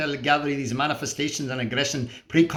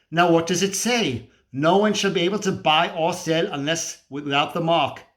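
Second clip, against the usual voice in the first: ordinary talk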